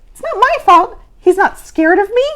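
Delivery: high, loud complaining whine; whining. Acted. Abigail whining to Mister Z about failing, high. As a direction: protest that it's unfair. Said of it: "she whined".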